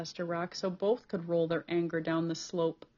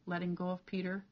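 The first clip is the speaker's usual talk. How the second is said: ordinary delivery, nothing marked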